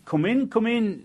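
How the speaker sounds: a high, bright-voiced eager welcome. Woman of the house eagerly welcoming the old women in from the rain.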